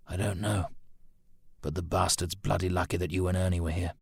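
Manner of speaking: soft, held-back answer; subdued grumble. Acted. Subdued answer, suppressed curse.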